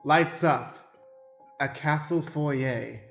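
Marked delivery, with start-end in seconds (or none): none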